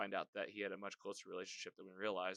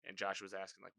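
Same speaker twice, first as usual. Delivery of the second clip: ordinary talk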